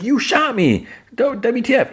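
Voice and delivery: high squeaky indignant voice; mock outrage. [name] yelling at John for shooting her, high mock outrage. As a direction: squeal it like a hammed-up offended victim.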